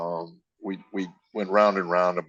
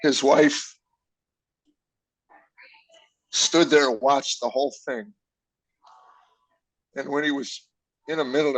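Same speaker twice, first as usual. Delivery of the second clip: real choked-up emotion; 3 semitones higher than usual. Real, not acted. Father recalling his son's wife comforting him, choked up. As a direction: fight back tears while telling it. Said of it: "I'm gonna get emotional".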